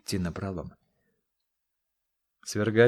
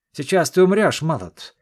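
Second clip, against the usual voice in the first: much higher, much louder triumphant threat; vicious threat; 8 semitones higher, 10 dB louder than usual. Acted. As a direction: scream it over the raised dagger. King about to stab prisoner.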